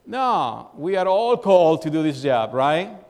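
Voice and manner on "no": ordinary talk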